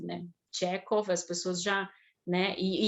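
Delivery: usual speaking voice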